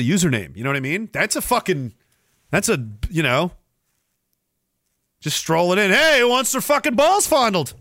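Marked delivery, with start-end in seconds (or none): 5.9-7.7 high, loud mock announcement; brash calling out. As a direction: holler it to the whole room. Imagines someone strolling in calling out.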